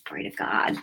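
breathy whisper, almost no voice; whispered praise. Real, not acted. Preacher whispers praise to God to herself. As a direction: let the praise fall to a hushed whisper.

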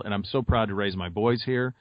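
ordinary talk, nothing marked

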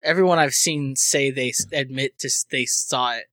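usual speaking voice

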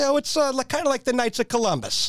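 a hint of an evasive old Nana; fumbling grandmother. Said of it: "He's like".

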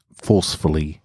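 usual speaking voice

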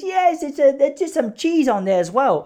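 very high, loud, breezy waiter; imitated waiter. Waiter assuring a vegan customer, high loud mock voice. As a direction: play a waiter blithely insisting, voice shooting up. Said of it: "He goes".

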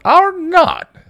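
high, loud squabbling voices; indignant playground comeback; childish bickering. A narrator's reading. Acted childish quarrel in story.

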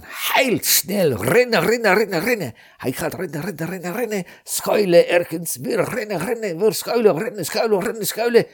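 high, urgent chant of running; breathless excitement. A narrator's reading. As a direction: rush it like fleeing for life. Frantic running from eagles.

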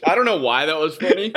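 coughing fit through laughter. Real, not acted. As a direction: choke and laugh at once. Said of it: "his aspiration event".

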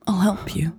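soft unvoiced whisper; gentle whisper. Elle to bruised Louise, gentle whisper.

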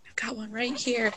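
soft whisper. Real, not acted. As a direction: whisper so the cat stays calm. Cat is right there.

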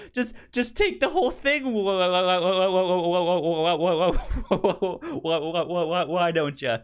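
can't get the words out for laughing; laughing through dad's line. Retold or imitated. Retelling dad's sarcastic line to the host, giggling helplessly. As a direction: quote dad while breaking up laughing. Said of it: "He goes".

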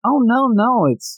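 high, dismayed "oh no"; mock dismay. Reacting to the scene, high mock dismay. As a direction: sound alarmed and high. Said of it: "And I'm like".

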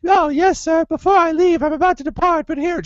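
high, chirpy put-on voice; mock sugary Shelby. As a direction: play her falsely sweet and eager. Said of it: "she's like".

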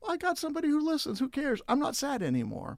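put-on high, loud, fast child's relief; happy kid. Crying kid from recess, suddenly cheered up, squealing. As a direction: rattle it off like a comforted child. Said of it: "this kid's like".

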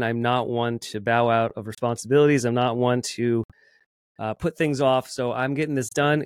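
usual speaking voice

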